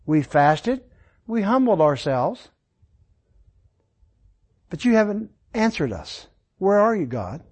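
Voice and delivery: the people asking God why he ignores their fasting, softly aggrieved; plaintive complaint. Retold or imitated. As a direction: mildly whine it as the neglected faithful. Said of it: "That's the complaint".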